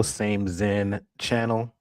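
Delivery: slow sign-off. Acted. Show sign-off catchphrase.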